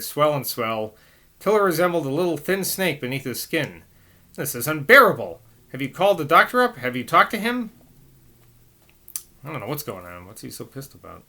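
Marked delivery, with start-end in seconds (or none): 4.3-7.7 loud, fast, higher indignant questions; outburst. Acted. Priest pressing the nurse about the doctor, loud and fast. 9.4-11.2 much softer muttered aside; quiet aside. Real, not acted. Reader muttering to listeners about the angry priest, very soft. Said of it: "reader's quiet aside".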